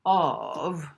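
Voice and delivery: ordinary delivery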